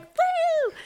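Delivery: high, loud, drawn-out "woo"; celebratory whoop. Retold or imitated. Mom celebrating the pool with her kids, high joyful whoop. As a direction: whoop it up over a small win.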